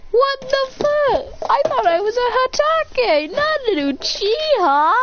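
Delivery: high, slow, wailing disbelief; stretched, swooping acted shock; shocked outburst. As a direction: cry it out in high-pitched dismay. Character shocked at his eyes.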